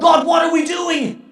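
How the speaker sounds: loud, high cry to God; anguished cry. Real, not acted. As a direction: shout the question at heaven. Prayer repeated as a cry.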